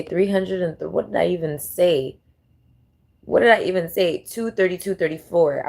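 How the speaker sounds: ordinary talk, nothing marked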